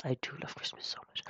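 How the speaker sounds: quick hushed whisper; whispered aside. Real, not acted. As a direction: whisper it quickly under breath. Whispered aside about loving Christmas.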